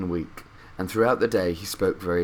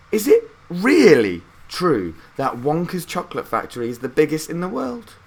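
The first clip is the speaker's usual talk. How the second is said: put-on a lighter, higher boy's voice full of wonder; Charlie asking; 8 semitones higher, 5 dB louder than usual. A narrator's reading. Charlie asking his grandparents about the factory, eager boy's voice. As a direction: ask like an eager little boy. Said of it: "he said to them".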